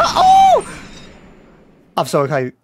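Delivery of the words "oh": very high cry of amazement; real high-pitched exclamation; excited "oh". Real, not acted. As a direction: squeal "oh" in delight. Amazed reaction to trailer footage.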